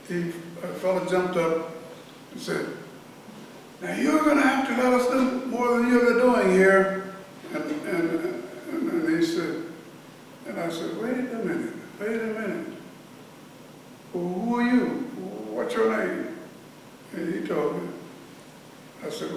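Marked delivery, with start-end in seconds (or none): 3.8-7.1 raised, louder demanding voice; loud demand from the crowd; retold shout. Inmate shouting demands at the director, raised voice. 11.0-16.4 firm interruption. Retold or imitated. Director cutting off the inmate.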